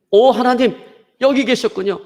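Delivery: high, acted cry of joyful recognition; relieved exclamation. Climber discovers God is there, high relieved exclamation. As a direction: exclaim upward in mock relief.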